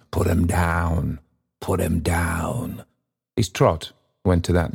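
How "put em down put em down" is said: slow, drawn-out chant winding down; measured, fading toll of words; slowing refrain. A narrator's reading. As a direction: let each repeat drag and settle. Refrain slows and settles.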